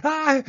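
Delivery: short high loud shriek. A narrator's reading. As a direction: shriek at the snake. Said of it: "Judy shrieked".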